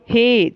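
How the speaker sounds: हे held long as a call; drawn-out call. Shown as a demonstration. Teacher repeats the calling हे, drawn out. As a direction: stretch हे out to call someone. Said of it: "जो खींच के जो बोलते हैं".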